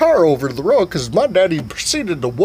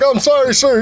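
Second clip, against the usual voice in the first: meek apology; 10 semitones higher, 3 dB louder than usual. Retold or imitated. General apologizing, high.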